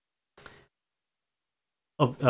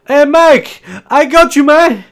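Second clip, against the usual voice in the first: high, loud buddy call across the room; cheerful shout; 13 semitones higher, 8 dB louder than usual. Acted. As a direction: yell it out to your friend. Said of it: "just goes".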